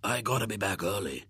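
put-on husky boy voice; hoarse voice. A narrator's reading. Disguised William excusing himself from the party, hoarse. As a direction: rasp it as a disguised voice. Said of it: "he said in his hoarse voice".

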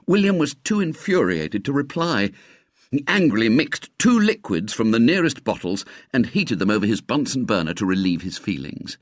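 ordinary delivery

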